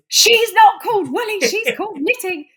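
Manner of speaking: loud, high-pitched indignant correction; retold outburst, shouting to put everyone right; exasperated mock yell, hammering the names; cross. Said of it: "I was getting so cross".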